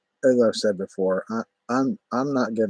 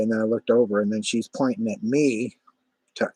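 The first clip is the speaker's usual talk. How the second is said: normal talking voice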